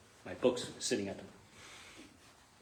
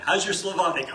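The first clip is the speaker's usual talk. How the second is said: teasing; 6 semitones higher, 8 dB louder than usual. Retold or imitated. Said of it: "he always teases me about that".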